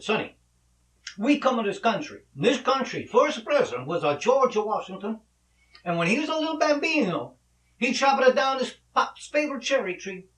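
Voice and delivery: high, loud hammed-up Italian father; bad Italian accent. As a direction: lecture your son in mock Italian. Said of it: "my Italian accent sucks".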